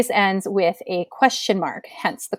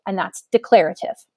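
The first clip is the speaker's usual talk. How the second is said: ordinary delivery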